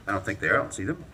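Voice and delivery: ordinary talk, nothing marked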